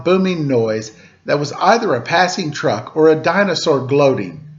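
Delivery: usual speaking voice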